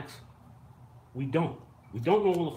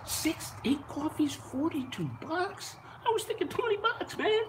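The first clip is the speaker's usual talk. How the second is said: much higher, incredulous squeak; mock outrage at price. Customer stunned by a forty-two dollar coffee bill, high mock squeak.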